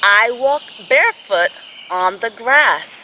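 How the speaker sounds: slow, gentle storybook reading; picture-book read-aloud with long pauses; reading to children. Acted.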